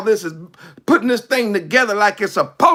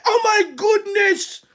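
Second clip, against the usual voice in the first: astonished; 9 semitones higher than usual. Acted. Astonished at his speed.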